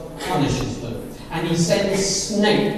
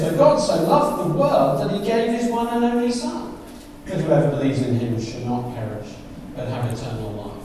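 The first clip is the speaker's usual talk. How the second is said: scripture reading; 5 semitones lower than usual. Acted. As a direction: read the verse tenderly. Reads verse sixteen.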